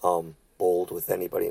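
soft, low, meek voice; mock gentle talk. Preacher mocking those who twist gentle into timid talk, soft mock-meek. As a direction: mimic overly gentle speech, hushed and low. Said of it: "talk like this".

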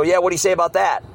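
imitating a high, quick heckler's challenge; mock impatient demand; mocker piping up. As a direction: pipe up pushily like a heckler. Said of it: "Then they pipe up again".

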